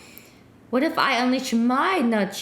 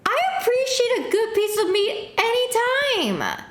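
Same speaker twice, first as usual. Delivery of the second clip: much higher, hammed-up squeal of delight; mock falsetto exclaim; 12 semitones higher than usual. Streamer teasing the flirty chatbot with a mock falsetto squeal. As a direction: shriek it in a put-on high voice like a giddy fan.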